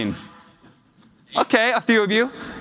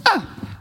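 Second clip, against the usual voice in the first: short, high little 'ah'; a tiny pitched-up sound of mock satisfaction; quick squeak as the toy fits; imitated child's relief.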